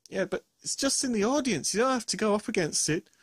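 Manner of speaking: ordinary talk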